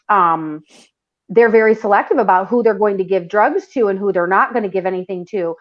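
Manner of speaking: usual speaking voice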